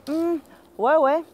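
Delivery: high sceptical hum into "ouais, ouais"; a bit ironic. Shown as a demonstration. French teacher shows disbelief, a bit ironic. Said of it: "a bit ironic".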